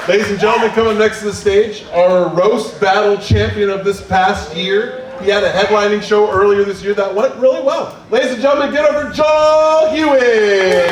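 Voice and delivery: announcer's rising introduction; comedy show announcer. Real, not acted. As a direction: hype the crowd for the next act. Host introduces next comedian.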